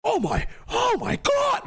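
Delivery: high, whiny disbelief rising to a shriek; squealing mock horror; mock shocked victim. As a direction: play the outraged snowflake. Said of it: "They'll be like".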